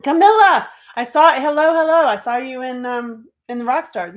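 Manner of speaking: high, loud, bubbly greeting; gushing hello from an eager fan. Retold or imitated. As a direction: squeal a fan's excited hello. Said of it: "she was telling me".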